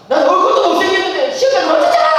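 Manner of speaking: high, loud complaining cry; whining teen outburst. Retold or imitated. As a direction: yell it out like a sulking son. Son complaining loudly.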